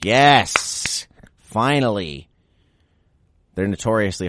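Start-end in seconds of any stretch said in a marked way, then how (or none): 0.0-2.3 mock cheer. Cheering the platypus news.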